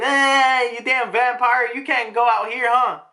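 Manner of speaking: put-on high, loud bullying kid; loud, high mock jeer; jeering taunt. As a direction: taunt her like a playground bully. Kids taunt Bonnie.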